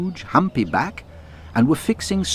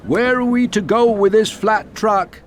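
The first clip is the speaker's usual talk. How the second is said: raised, louder call across the yard; 13 semitones higher, 5 dB louder than usual. A narrator's reading. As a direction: call to the signalman. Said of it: "driver called out".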